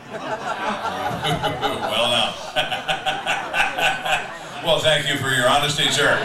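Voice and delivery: loud, laughing mock thanks; loud laughter breaking through sarcastic thanks to the killer. Real, not acted. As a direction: laugh it out while thanking him.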